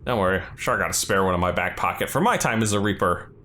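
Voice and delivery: ordinary talk, nothing marked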